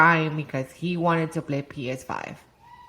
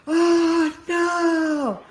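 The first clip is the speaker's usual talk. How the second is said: high, loud crying cry of dismay; high, loud child's wailing no; kid's wail; 12 semitones higher, 6 dB louder than usual. Retold or imitated. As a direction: wail it like a heartbroken kid. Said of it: "and he's like".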